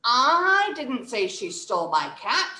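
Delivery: "I" long and loud, rising end; stress on I. Shown as a demonstration. Teacher denying it was them, strong stress on "I". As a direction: punch and hold "I", lift at "cat". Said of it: "Let's stress the first word".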